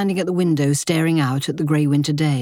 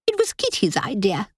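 high, sulky grumble; 6 semitones higher than usual. A narrator's reading. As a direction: pout and shift the blame. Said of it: "Linda said sulkily".

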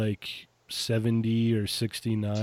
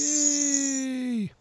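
a much higher, jokey little yay; mock cheer. Real, not acted. Proud of the new shredder cape, mock celebrating.

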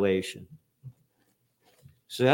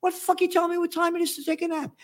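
mock squeaky rant; much faster than usual. Mocking authority, ranting at it.

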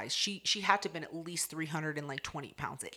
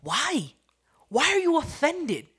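high, loud incredulous question; exaggerated disbelief; indignant challenge; 9 semitones higher, 7 dB louder than usual. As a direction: demand an answer, pitch leaping up. Challenging the offended girl.

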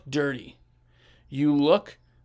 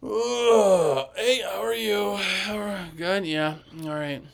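high, slow, groaned greeting; mock worn-out whine, trailing down; groaning reluctant greeting; 5 semitones higher, slower than usual. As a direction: drag the hello out through a groan. Acting out a miserable worker arriving.

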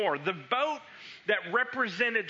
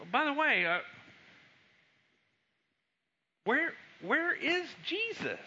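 worried disciple; 7 semitones higher than usual. Retold or imitated. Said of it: "I can imagine them thinking this".

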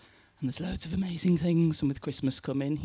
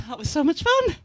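bright and bubbly, pitched up; excited, high; 12 semitones higher, 5 dB louder than usual. Real, not acted. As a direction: gush it out happily. First of two takes.

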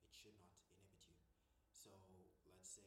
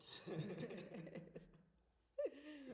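long high burst of laughter; loud laughter; 17 dB louder than usual. Real, not acted. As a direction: crack up helplessly. Laughing at own slip.

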